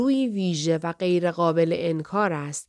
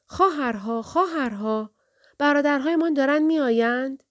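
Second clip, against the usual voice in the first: high, sustained call to her sisters; crying out; 7 semitones higher than usual. A narrator's reading. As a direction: call out, lifting high on the last line. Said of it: "زن جوان دوباره فریاد زد".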